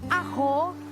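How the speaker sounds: ordinary talk, nothing marked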